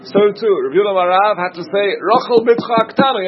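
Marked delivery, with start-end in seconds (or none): 2.0-3.2 "Rochel Bitcha Haktana" pitched high with each word stressed; insistent, raised "Rochel Bitcha Haktana"; emphatically spelled out. Shown as a demonstration. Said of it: "have to spell out exactly".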